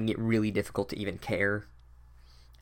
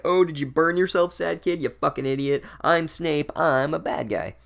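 higher and louder sneering villain voice; mocking Snape; 5 semitones higher, 3 dB louder than usual. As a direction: jeer at the sad kid like a cartoon baddie. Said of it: "and Snape is like".

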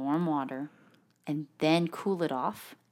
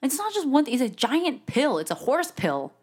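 indignant protest; 9 semitones higher, 4 dB louder than usual. Real, not acted. Defensive protest.

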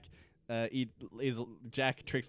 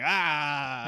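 loud, drawn-out monster cry; smoke monster roar; 8 dB louder than usual. Retold or imitated. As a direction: imitate a monster's long loud exhale. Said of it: "smoke monster is just him going".

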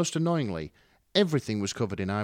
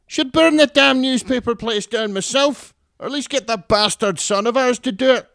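much higher and louder, yapping terrier voice; grating Scottish mum; 11 dB louder than usual. Acted. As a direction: yap it like a cranky little terrier. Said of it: "Her voice was grating".

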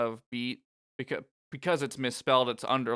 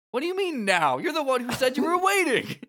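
high and loud, indignant; playful over-the-top protest; mock exasperation; 12 semitones higher, 6 dB louder than usual. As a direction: throw it back in disbelief. Mock exasperated reply to Joshua.

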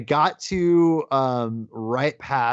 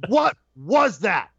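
slow, high-pitched incredulous demand; mock outrage, each word drawn out; outraged disbelief; 7 semitones higher, much slower than usual. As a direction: punch each word in exasperation. Reenacts outrage after the film.

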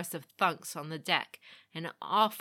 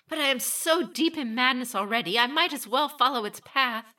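reckless resolve; 7 semitones higher, 6 dB louder than usual. A narrator's reading. Meiko resolves herself.

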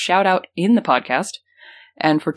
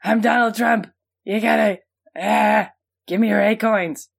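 Donald Trump; 4 semitones higher than usual. Acted. Mock Donald Trump impression, self-introduced.

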